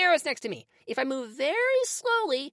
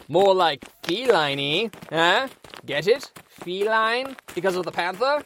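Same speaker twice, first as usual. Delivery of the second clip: smug pun; 10 semitones lower than usual. Acted. Knight makes a pun.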